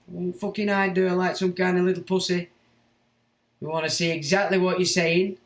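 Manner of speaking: real anger at the critic; angry challenge. Real, not acted. Goading the critic to reply.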